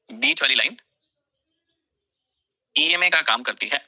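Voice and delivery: slow, measured dictation with long pauses. Real, not acted. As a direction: stretch the phrases for students writing along. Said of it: "मैं बहुत धीरे धीरे बोलता हूँ". (धीरे-धीरे बोलकर लिखवाना)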